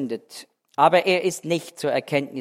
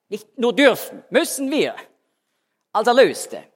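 higher, louder insistence; emphatic preaching; 7 semitones higher, 5 dB louder than usual. Acted. Preacher urging the congregation, higher and louder. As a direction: drive the point home, rising.

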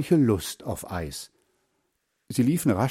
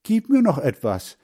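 put-on high, louder boyish begging; pleading; 8 semitones higher, 5 dB louder than usual. A narrator's reading. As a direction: beg a friend for more. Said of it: "bat Hans Frieder". (bittend, mit hoher Stimme)

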